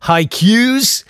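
haikus as 'high-queues'; mispronounced haikus. Retold or imitated. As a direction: stretch haikus into 'high-KYOOZ'. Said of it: "He pronounced them haikus".

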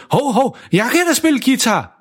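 eager dad volunteering; mild mock shout. Father volunteering to play guitar, mock shout. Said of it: "råber han meget højt".

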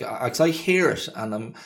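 usual speaking voice

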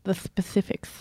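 "specifics" with slushy, wet s sounds; "specifics" slurred into a wet mouthful; slushy stumble. Real, not acted. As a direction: trip wetly over the s sounds in "specifics". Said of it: "I'm sure that was sounded delightful".

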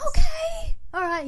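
very high startled okay; squeaky taken-aback agreement; high surprised okay. Retold or imitated. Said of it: "And he was like".

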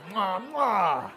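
smack the kisses out; kiss sounds. Retold or imitated. Inmate kissing the barber, playful kiss sounds.

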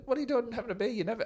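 high, incredulous imitating voice; mock disbelief. Teammate teasing Warnie about the beer, high mock disbelief. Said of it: "and go".

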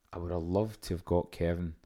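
ordinary talk

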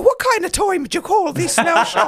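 high, loud, scolding mock-Irish; indignant Irish accent, badly faked; attempted Irish accent. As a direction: tell them off in a bad Irish accent. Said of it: "now it's going into the Jamaican".

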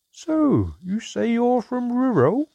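put-on high, slow, curious old dwarf voice; a sly innkeeper dwarf fishing for answers; probing dwarf. A narrator's reading. Dwarf questions mother.

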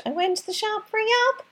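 high, singsong Cork lilt on yap; putting on a Cork accent for a comedian's line; mock Cork accent. Host quoting a Cork comedian's famous line in a Cork accent. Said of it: "Chris Kent had a bit".

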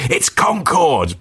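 mock indiscreet blurt, high and stretched; slow, high-pitched imitation of failed discretion; too-loud quiz answer. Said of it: "I was actually saying it quite loudly".

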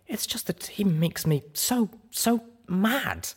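high, exasperated mare voice; frustrated outburst. A narrator's reading. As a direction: burst out in frustration. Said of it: "burst out with a stomp".

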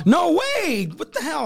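high-pitched, horrified protest; appalled disbelief. Real, not acted. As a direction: recoil in real disbelief, pitch jumping up. Appalled that there is a video.

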